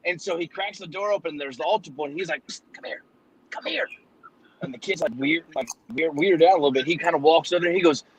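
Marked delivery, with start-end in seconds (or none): 2.5-3.9 hushed, breathy psst and call; whisper. Acted. Priest hiding in the booth whispering for the altar boy, hushed. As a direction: sneak the words out so no one hears.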